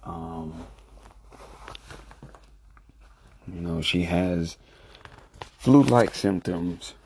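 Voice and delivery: soft, hushed voice so as not to wake someone; low talking. Real, not acted. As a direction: keep it low, talking quietly. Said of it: "kinda low talking right now 'cause I don't want to disturb her".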